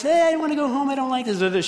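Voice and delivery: put-on high-pitched little girl complaint; complaining child. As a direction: imitate a child pleading to go home. Child complaining, voiced by her father.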